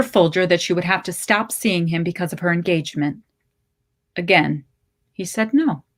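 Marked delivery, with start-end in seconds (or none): none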